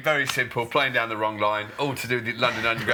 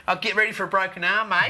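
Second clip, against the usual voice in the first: mock sledge; 8 semitones higher than usual. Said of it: "Michael Clarke going up to Jimmy Anderson going".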